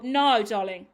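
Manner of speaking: loud, emphatic clapback; sassy, loud rebuttal with NOT stressed; defiant retort. Real, not acted.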